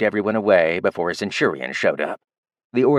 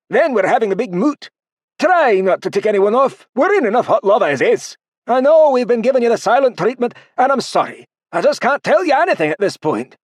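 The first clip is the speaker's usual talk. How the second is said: high, loud, urgent dwarf voice; anxious dwarf leader; 13 semitones higher, 5 dB louder than usual. Acted. Don warning Artorian before the moot, high, loud and urgent. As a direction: plead and warn him, worried.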